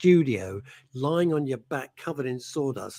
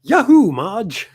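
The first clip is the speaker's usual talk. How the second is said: slow, louder, higher cartoon whoop; Homer Simpson; 8 semitones higher, 9 dB louder, much slower than usual. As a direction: whoop like Homer calling to his wife. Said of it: "I do Homer Simpson".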